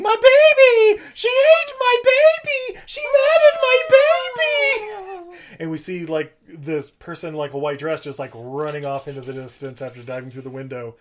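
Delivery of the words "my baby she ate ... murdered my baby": slow, very high, very loud shrieking wail; hysterical scream. Retold or imitated. Retelling the asylum woman's hysterical screaming, very high and loud. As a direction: howl it out like a mother gone mad. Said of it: "someone screaming".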